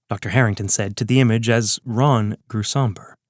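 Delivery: ordinary delivery, nothing marked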